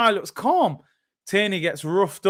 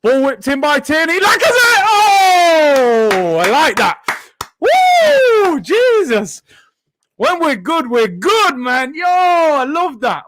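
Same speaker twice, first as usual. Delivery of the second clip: much higher and louder, whooping at the chance; getting excited; 9 semitones higher, 5 dB louder, slower than usual. Real, not acted. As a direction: lose yourself in the attack, shouting. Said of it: "Why am I getting excited?".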